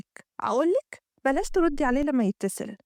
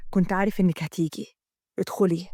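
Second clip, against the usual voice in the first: lower, confident man at the door; lowered male voice, knowing; 6 semitones lower than usual. Acted. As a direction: welcome her in, sure of himself. Amgad greets her at door.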